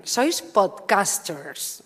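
dragging each word out, mocking; slow dismissive quote. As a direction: stretch «sois podcasters» very slowly. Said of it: "te dicen". («Sois podcasters» arrastrado con burla)

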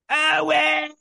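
high-pitched mock shout; hammed-up, drawn-out yell; imitated mama's holler. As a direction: bellow for your son like an overbearing movie mama. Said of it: "Throw Mama from the Train".

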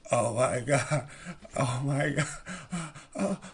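mock swooning. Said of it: "And I was like".

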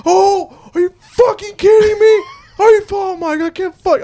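high, loud imitation of a livid outburst. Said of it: "I saw him absolutely livid".

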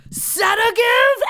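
drawn-out, high-pitched shout; comic bellow imitating a movie line; imitated yell. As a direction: yell it out slowly, high and loud. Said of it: "when he yells sedative".

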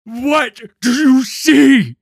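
high, slow, screamed demand; anguished, furious bellow; enraged shout. Acted. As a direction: hammer out each word at full voice. Enraged mentor confronts boy.

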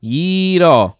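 exaggerated "Gyro", drawn-out ee and tapped r; Greek pronunciation of gyro. Shown as a demonstration. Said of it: "that's exactly how you say it".

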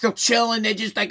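ordinary talk

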